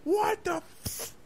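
very high, slow stunned gasp; shocked shriek. Retold or imitated. Chef shocked at being drenched, shrieking. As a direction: cut off mid-curse in shock. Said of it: "she's just".

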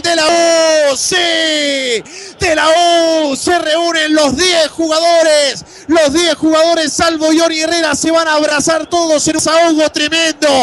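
high shouted celebration call; euphoric goal commentary. Real, not acted. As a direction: shout it out in joy. (narración eufórica de gol)

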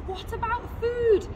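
worried mother bird. A narrator's reading. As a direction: pipe up in a high character voice. Mother bird worrying about food.

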